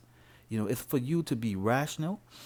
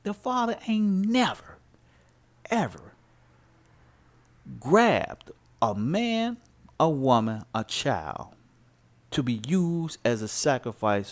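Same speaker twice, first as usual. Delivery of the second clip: said with my chest; 2 semitones higher, 4 dB louder than usual. Real, not acted. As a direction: proclaim it from the chest, hammering each word. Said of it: "Let me say it with my chest".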